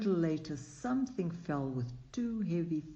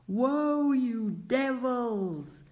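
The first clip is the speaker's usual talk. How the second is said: high, loud yell, slow on devils; desperate driver yelling at bolting horses; 9 semitones higher, 6 dB louder, much slower than usual. A narrator's reading. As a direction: bellow at the runaway horses.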